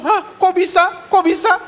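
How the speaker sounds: high, hammed-up gasp of shock; preacher mocking shocked churchgoers. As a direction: overplay the surprise, way up high. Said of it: "Nggak usah kaget-kaget gitu".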